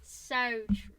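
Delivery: broken-off Australian attempt; failed Australian accent. Shown as a demonstration. Trying to copy co-host, botched Australian accent. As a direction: start an Australian "so true" and give up. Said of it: "I cannot do Australian accents".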